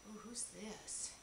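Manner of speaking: curious soft whisper. Real, not acted. Peeking at the next gift, whispering curiously. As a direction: lean in and whisper.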